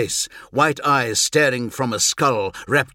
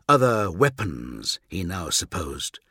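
ordinary delivery

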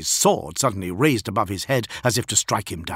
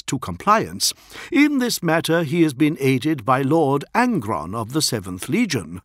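higher, measured envoy's report; lightly voiced official envoy; 5 semitones higher, 5 dB louder than usual. Envoy briefs a primarch on the war, formal.

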